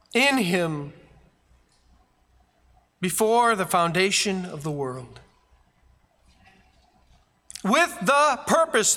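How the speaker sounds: high, loud, driving preacher's voice; preaching at full force. Acted. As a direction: thunder it at the congregation like a revival preacher. Preacher proclaims Ephesians passage.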